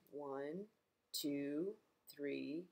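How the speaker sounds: quiet low counting; soft counting. Acted. Librarian counting candles for kids, softly.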